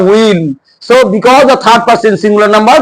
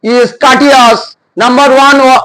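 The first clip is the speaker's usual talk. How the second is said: ordinary talk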